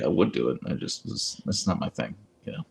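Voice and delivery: ordinary delivery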